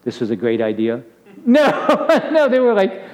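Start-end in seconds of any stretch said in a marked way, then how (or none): 1.4-3.0 high, laughing denial. Real, not acted. Speaker answering his own question about his parents, laughing. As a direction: burst out laughing on the no.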